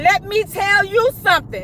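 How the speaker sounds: high, sharp start to a telling-off; about to let the nurse have it; telling her off. Retold or imitated. Woman fresh from anesthesia starts telling off the nurse, high and sharp. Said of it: "I let her have it".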